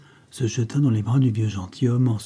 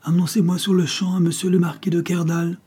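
loud, higher commanding call; 8 semitones higher, 3 dB louder than usual. Acted. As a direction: demand entry, startling the house. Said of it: "une voix qui les fit tressaillir".

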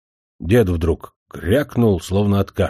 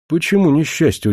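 raised pitch, astonished question; taken aback, asking why; surprised; 7 semitones higher, 3 dB louder than usual. A narrator's reading. Young suitor answering the grandfather, surprised. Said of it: "удивился Андрейка".

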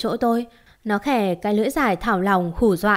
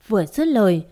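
usual speaking voice

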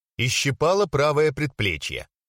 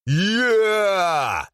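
loud higher growl; growled shout; 8 semitones higher, 4 dB louder than usual. Acted. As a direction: roar from the stage. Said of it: "зарычал кто-то со сцены".

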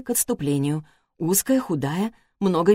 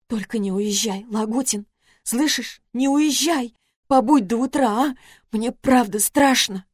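higher, pleading, sobbing; frightened tearful plea; 5 semitones higher than usual. Acted. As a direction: beg him not to leave. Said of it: "Даша всхлипнула".

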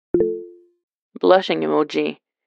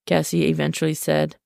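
plain reading voice

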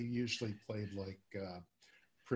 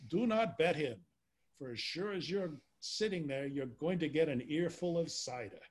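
put-on New York tough-guy accent, higher and louder; New York accent, bad on purpose; worst Damon Runyon accent; 5 semitones higher, 3 dB louder than usual. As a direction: open like an old New York gambler. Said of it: "read in my worst, uh, Damon Runyon accent".

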